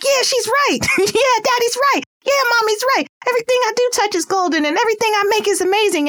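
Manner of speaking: put-on high, fast, puffed-up kid voice; proud child. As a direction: play a kid who believes every compliment. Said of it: "they're gonna be all built up like".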